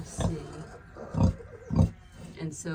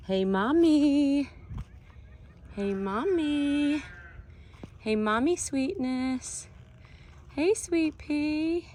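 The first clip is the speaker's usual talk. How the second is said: much higher, slow soothing coo; 9 semitones higher, slower than usual. Real, not acted. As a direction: coo gently to calm a nervous sow. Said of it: "I don't wanna startle her".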